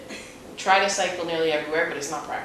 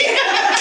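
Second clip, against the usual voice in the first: a high, loud burst of laughter; 5 dB louder than usual. Real, not acted. Joking about taking the bus, a real laugh. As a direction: she breaks up laughing. Laughing at her own story.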